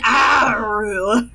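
slow howled wolf call. Shown as a demonstration. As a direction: howl it out like a wolf. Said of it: "this is not an awoo".

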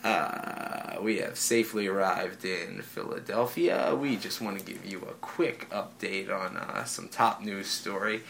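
lightly voiced captain on the intercom; airline captain announcement. Captain updating passengers on top news, lightly imitated intercom manner. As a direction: imitate a low-key, measured cockpit announcement. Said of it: "over the intercom".

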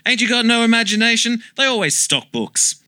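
high exasperated jab. Acted. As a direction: scoff at his dumb idea. Exasperated at sidekick.

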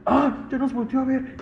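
very high mock-panicked woman's voice; imitated frightened cry. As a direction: imitate a woman shrieking that she's been spotted, without full volume. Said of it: "Y de repente grita". (grito agudo de susto imitado)

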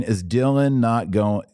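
usual speaking voice

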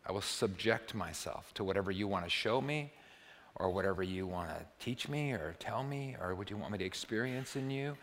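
soft-spoken, humble prayer; contemplative prayer. Acted. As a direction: offer it quietly to God. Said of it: "a contemplative prayer".

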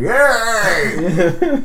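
high, loud goofy cheer as Skid; squeaky Yay. Acted. Stoner Skid cheering at the party, high and loud. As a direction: squeal it with a laugh.

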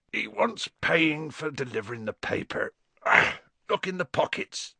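throat-clearing, half-awake giant; Hagrid's gruff waking voice. A narrator's reading. Sleepy Hagrid telling Harry to pay, gruffly. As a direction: explain it roughly from the sofa.

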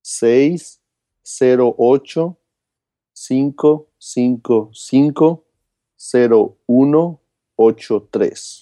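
each digit spaced out slowly; slow and deliberate. Shown as a demonstration. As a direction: read the number back slowly for clarity. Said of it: "Otra vez, por favor, y más despacio".

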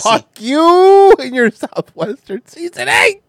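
high, loud, slow mock rage; mock angry yell. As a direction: yell it at your friend jokingly. Playful outburst at friend.

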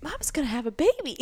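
put-on child blurting. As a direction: blurt it out like a three-year-old. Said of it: "Hayden is immediately like".

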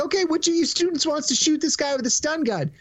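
high-pitched eager classroom question; cop addressing children. Retold or imitated. Cop asks visiting students for a volunteer, high presenter voice. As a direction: pitch it up as if inviting young volunteers.

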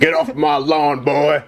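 put-on slow, hammy cranky old man; grumpy old man. Mock grumpy parent.

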